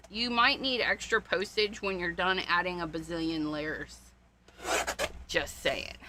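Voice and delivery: normal talking voice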